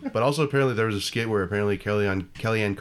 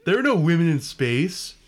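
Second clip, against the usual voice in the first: mock whiny fan; 8 semitones higher, slower than usual. Mocking sexist Star Wars fans.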